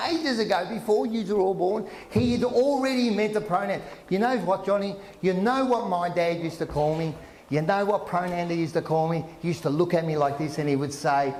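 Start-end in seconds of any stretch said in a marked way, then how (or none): none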